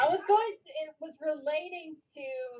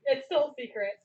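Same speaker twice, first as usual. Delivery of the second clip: ordinary talk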